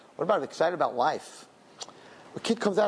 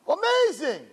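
overjoyed exclamation; 13 semitones higher, slower than usual. Shown as a demonstration. Answering how's it going, over-the-top excited Amazing. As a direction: burst out high and bright with a big, joyous Amazing. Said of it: "too much emotion at one moment".

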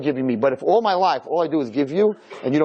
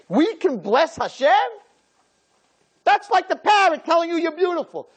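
high-pitched, astonished exclamation; incredulous outcry; 13 semitones higher than usual. Real, not acted. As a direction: cry it out in sheer disbelief. Incredulous that humans bless God.

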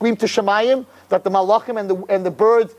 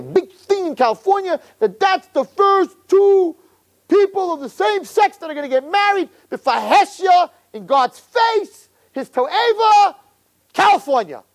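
preacher's fury building over the sinful city; rising outrage; 9 semitones higher than usual. Real, not acted. Rabbi's rising outrage at California.

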